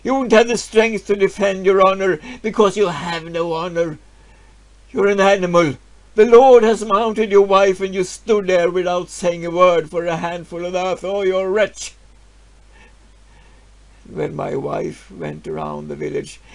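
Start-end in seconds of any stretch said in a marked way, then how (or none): 0.0-11.9 loud scornful taunting; jeering villagers. Acted. Villagers taunt the man for his dishonor, loud scorn. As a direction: taunt him without mercy. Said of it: "They shouted".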